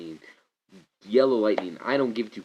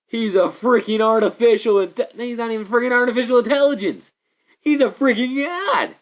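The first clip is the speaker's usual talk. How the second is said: much higher and louder, heated and emphatic; rising rant; 10 semitones higher, 7 dB louder than usual. Real, not acted. Fan ranting to viewers about Zordon, heated loud rant. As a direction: build it into an exasperated outburst.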